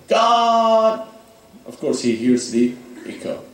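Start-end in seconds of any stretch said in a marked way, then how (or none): 0.1-1.1 high loud cry to God; a stranded climber shouts for God; screaming God. A narrator's reading. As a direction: call out to God across the mountain. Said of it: "He started screaming".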